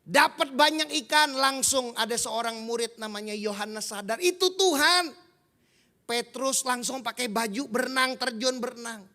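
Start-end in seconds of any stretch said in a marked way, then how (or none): 4.2-5.2 higher and louder, a sudden realisation; the disciple cries out that it is the Lord; excited exclaim. Acted. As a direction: blurt it out as you recognise him. Disciple recognizes Jesus, exclaims.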